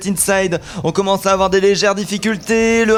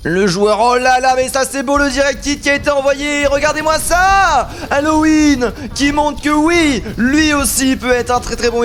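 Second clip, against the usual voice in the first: excited; 7 semitones higher than usual. Real, not acted. Caster hyped by a big play.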